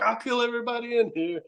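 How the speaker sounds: mock gangster threat, hammed up a little; Joe Pesci threat. Imitating Pesci threatening the stoned guys in the corner, mock menace. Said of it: "I'm like Joe Pesci and fucking Goodfellas".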